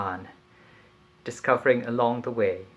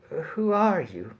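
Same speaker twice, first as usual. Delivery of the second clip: slurred; 8 semitones higher, 3 dB louder, slower than usual. Acted. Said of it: "I slurred".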